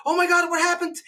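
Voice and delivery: high alarmed gasp of a parent; mock alarmed parent. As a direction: play the panicked parent, pitch up. Parents' alarmed reaction quoted.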